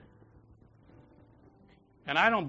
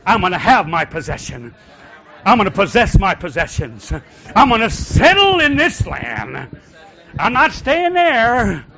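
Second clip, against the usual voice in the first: rising declaration; 6 semitones higher, 16 dB louder than usual. Shown as a demonstration. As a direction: build the claim until it rings. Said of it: "Say it again".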